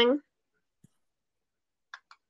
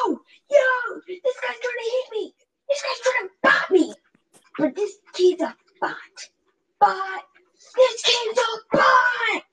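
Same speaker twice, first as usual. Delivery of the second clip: high excited squeal; 13 semitones higher, slower than usual. Real, not acted.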